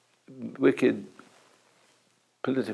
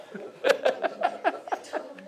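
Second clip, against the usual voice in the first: high giggling laugh. Real, not acted. Laughing after mimicking his wife, high giggle.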